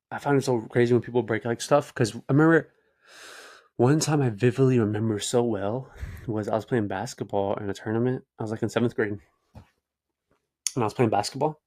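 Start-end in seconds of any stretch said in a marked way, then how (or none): none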